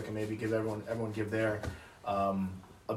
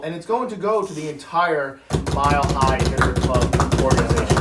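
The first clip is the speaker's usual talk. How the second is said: award announcement; 3 semitones higher, 9 dB louder than usual. Real, not acted. Host reveals Rookie of the Year winner, announcing loudly.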